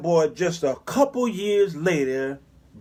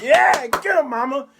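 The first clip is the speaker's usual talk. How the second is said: cheering the mother on, raised pitch; high egging on; 6 semitones higher than usual. Retold or imitated. Preacher cheering the mama on against the boy, high egging on. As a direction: cheer her on from the side. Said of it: "I was like".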